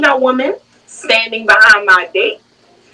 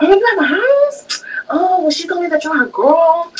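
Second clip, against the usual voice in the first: mock surprised mother; 7 semitones higher than usual. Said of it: "She's like".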